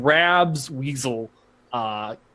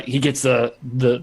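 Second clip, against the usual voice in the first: normal talking voice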